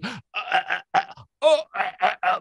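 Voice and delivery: high, strained gasping "ah, ah"; imitated choking gasps. The mother choked by her inner part, imitated gasping. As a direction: gasp as if being choked. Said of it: "tiba-tiba dia gini".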